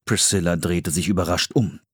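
ordinary delivery, nothing marked

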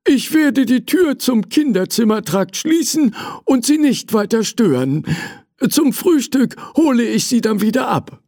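put-on high-pitched, obliging old manservant; friendly old servant. Old servant obeying the young prince, high and obliging. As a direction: humour the young master in a high old man's voice. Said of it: "ein älterer, freundlicher Mann".

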